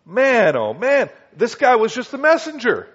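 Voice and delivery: stunned "man, oh man"; amazed exclamation. Real, not acted. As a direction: marvel out loud at the story. Preacher marvels at the story.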